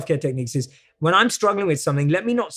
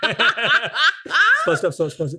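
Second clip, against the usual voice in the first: laughing through words; 10 semitones higher, much slower than usual. Real, not acted. Laughing tongue-twister fumble.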